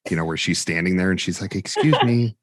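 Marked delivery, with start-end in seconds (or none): 1.5-2.4 lightly voiced timid lady, higher and louder; hint of the shy lady squeezing past; imitating the meek Police Academy lady asking to get by; meek polite lady. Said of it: "the real quiet lady from".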